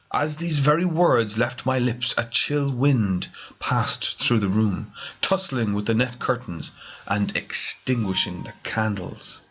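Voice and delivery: ordinary delivery, nothing marked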